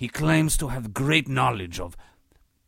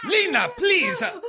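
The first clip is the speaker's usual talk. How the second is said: much higher, strained call of her name; exasperated cry. Acted. Host loses patience with Lena in front of guests, exasperated cry.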